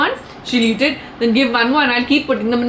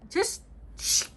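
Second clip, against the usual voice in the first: unvoiced shh; hushing; 8 semitones higher, 12 dB quieter than usual. Real, not acted. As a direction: hush him in a whisper. Hushing Jerry.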